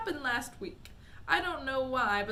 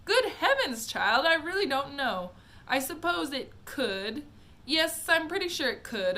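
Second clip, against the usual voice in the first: flustered; 5 semitones higher, 8 dB louder than usual. Acted. Wonka flustered answering Mike.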